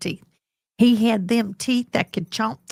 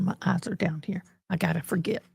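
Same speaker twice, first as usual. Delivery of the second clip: whispered aside; 8 dB quieter than usual. Real, not acted. Streamer whispering to herself about the camera. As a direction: drop to a whisper. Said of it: "whispered aside".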